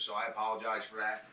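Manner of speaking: ordinary talk, nothing marked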